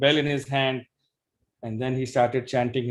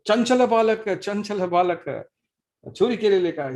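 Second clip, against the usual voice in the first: outraged scream; 7 semitones higher than usual. Acted. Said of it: "And he screamed".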